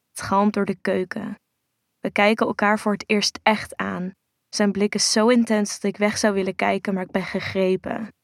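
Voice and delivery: usual speaking voice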